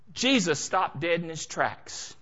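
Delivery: ordinary talk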